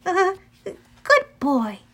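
slow, high, wavering praise; melancholy and uncertain. A narrator's reading. Annabeth says farewell to Cerberus, melancholy and uncertain. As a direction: praise hesitantly, with sadness in it. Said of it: "her voice sounded melancholy and uncertain".

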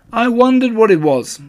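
high, loud bouncy voice; Tigger voice. Acted. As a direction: blurt it out brightly. Tigger answering Pooh.

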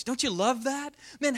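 ordinary delivery

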